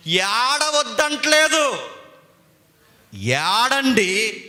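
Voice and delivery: very slow, drawn out; words pulled long for weight; slow emphatic. Shown as a demonstration. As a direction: stretch each word to drive it home. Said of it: "మళ్ళీ చెప్తున్నాను".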